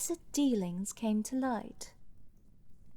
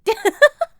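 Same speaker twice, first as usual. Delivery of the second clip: high, much louder delighted cackle; loud haughty laugh; 12 semitones higher, 16 dB louder than usual. Acted. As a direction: burst out in a loud, shrill, self-satisfied laugh. Laughing at her own flirtation.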